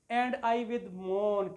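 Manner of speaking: very slow, drawn-out reading of the verse; reading poetry aloud to a class; slow poem recital. Shown as a demonstration. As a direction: savour each word as you recite it. Teacher reciting a poem line.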